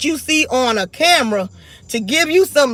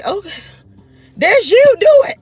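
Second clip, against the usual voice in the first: mock taunt; 9 semitones higher, 5 dB louder than usual. Daring people who threaten her.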